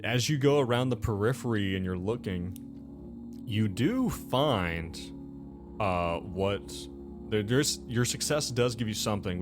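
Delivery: ordinary delivery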